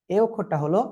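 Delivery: ordinary talk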